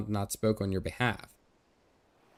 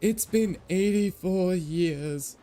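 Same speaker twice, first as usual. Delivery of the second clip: put-on slow, drawn-out old-lady line, pitched higher; meme quote; 8 semitones higher, much slower than usual. Streamer quoting the eighty-four years meme, slow and drawn out. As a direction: stretch it out like the famous meme. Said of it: "the meme".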